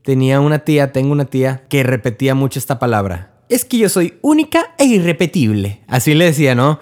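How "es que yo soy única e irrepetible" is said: mock the aunt's self-important boast; hammed-up proud aunt, nose in the air; imitating a haughty aunt. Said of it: "lo sentía de una manera muy soberbia".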